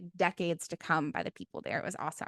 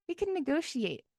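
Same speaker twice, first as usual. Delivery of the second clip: mock naive voice; 5 semitones higher than usual. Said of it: "'cause they're like".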